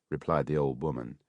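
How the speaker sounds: ordinary delivery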